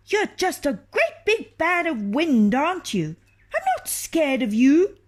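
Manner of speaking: mock the giant in a small bold voice; tiny taunting voice.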